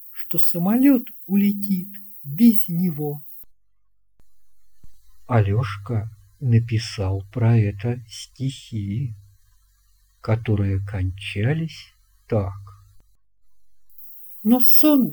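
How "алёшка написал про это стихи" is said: plain reading voice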